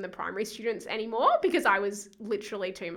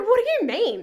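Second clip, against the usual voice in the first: baffled; 12 semitones higher, 9 dB louder than usual. Retold or imitated. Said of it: "they're like".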